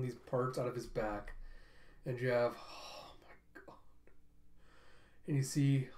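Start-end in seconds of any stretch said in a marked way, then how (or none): 2.6-3.8 breathless whispered oh my god; barely audible stunned whisper; awed whisper. Real, not acted. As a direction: hush it under your breath in awe. Awed aside while retelling fight.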